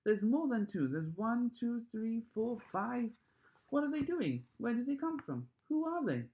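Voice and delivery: high, loud, tumbling cries; counting in wonder; the crowd shouting over each other at the little men; excited shouting. A narrator's reading. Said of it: "everyone started shouting at once".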